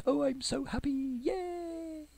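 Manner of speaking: real delight at the coming delivery; gleeful. Real, not acted. Thrilled as delivery comes.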